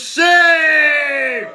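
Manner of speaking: mock shout. Reacting to farm funding news with a fake outraged shout. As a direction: bellow it like a scandalized senator.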